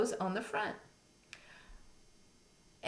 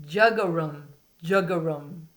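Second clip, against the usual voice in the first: deep croaking jug-a-rum, louder; bullfrog call; 3 semitones lower, 5 dB louder than usual. Shown as a demonstration. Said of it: "A bullfrog says".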